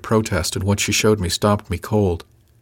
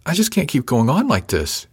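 higher, distressed, near-tearful plea; half sob; 7 semitones higher than usual. A narrator's reading. She tells him she can't go on, half sobbing. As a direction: plead on the edge of a sob. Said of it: "she said with a half sob".

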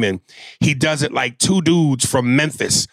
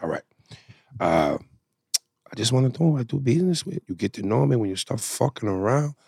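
quiet mob movie voice; 5 dB quieter than usual. Acted. Acting out a movie scene.

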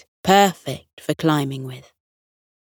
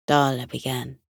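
ordinary delivery, nothing marked

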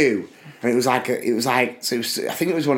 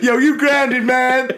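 mock teen jeer; 9 semitones higher, slower than usual. Kid to grounded mate after rehearsal, high mock taunt. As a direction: call it out in a squeaky, hammed-up voice.

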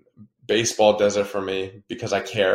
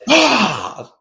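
high, loud "ah" of frustration; re-enacted yell after losing; frustrated yell; 12 semitones higher, 6 dB louder than usual. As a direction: yell out like you just lost a match. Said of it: "yelling afterwards, like".

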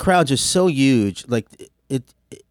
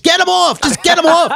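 high, loud mock yell; mock backstage scream; 7 dB louder than usual. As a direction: yell from the wings to clear the stage. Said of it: "they're screaming from backstage".

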